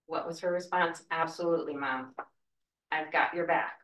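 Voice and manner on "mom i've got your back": normal talking voice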